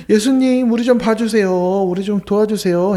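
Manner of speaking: high, loud plea that eases off; begging. Acted. Blind men begging Jesus face to face, urgent plea. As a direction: beg him for help, voice pushing up.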